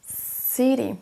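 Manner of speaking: "city" with a strong first syllable and falling end; stress on first syllable. Shown as a demonstration. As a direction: hit the start of "city", relax the second syllable. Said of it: "On accentue sur la première syllabe".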